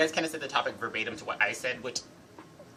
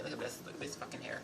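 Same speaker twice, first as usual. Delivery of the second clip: hushed, breathy whisper to the camera; 3 semitones higher, 9 dB quieter than usual. Real, not acted. Showing viewers her mermaid hair, in a whisper. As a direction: whisper it like a secret.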